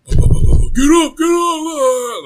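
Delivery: high, loud hollering, imitated; drunk pest. Drunk guy at the bedroom door, mock hollering. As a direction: mimic a drunk pestering someone awake. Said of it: "He's drunk, you know".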